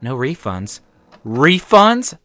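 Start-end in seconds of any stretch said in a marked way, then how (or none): 1.2-2.2 high, loud incredulous question; indignant, rising echo; sputtered disbelief. A narrator's reading. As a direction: throw the word back, outraged. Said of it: "I sputtered".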